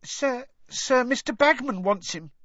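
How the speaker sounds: very high, nervous squeak; anxious little boy's piping voice; nervous schoolboy. A narrator's reading. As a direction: venture it timidly to a stern teacher. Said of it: "he said nervously".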